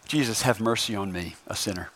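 very low, soft, humble plea; hushed prayer. Shown as a demonstration. A sinner prays to Jesus for mercy, hushed. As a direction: pray it quietly, dropping away at the end. Preacher models a personal prayer.

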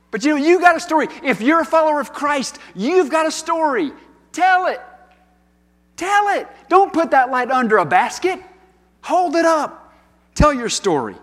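rally the congregation to speak up; fervent preaching. Acted. Preacher urging the congregation to share their story, fervent.

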